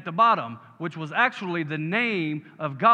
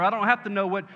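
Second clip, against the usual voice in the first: louder, higher climax, slowing at the end; full-force preaching; 4 semitones higher, 7 dB louder than usual. Real, not acted. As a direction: build to a triumphant amen. Sermon climax.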